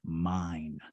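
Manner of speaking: low, drawn-out ghostly claim; one word, held long and low; deep voice. Retold or imitated. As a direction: imitate a deep, looming presence. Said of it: "this deep voice".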